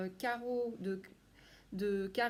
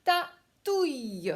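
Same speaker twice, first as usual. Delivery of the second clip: ratatouille said slow, loud and higher; teacher presenting the next word; clear word demo; 4 semitones higher, 7 dB louder, slower than usual. Shown as a demonstration. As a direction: announce ratatouille slowly and loudly to the class. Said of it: "pour ce mot, ratatouille, combien de syllabes".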